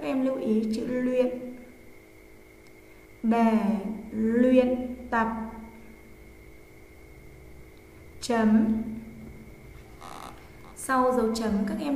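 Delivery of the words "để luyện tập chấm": slow, word by word dictation; dictating. Shown as a demonstration. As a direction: read slowly for pupils to write. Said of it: "cô giáo sẽ đọc, hai đến ba lần".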